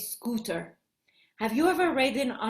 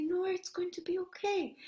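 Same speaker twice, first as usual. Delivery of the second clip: soft, higher comforting voice; soothing; 4 semitones higher, 9 dB quieter than usual. Acted. Hannah comforts Noor with a bloody knee, soft soothing. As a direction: hush a hurt little one tenderly.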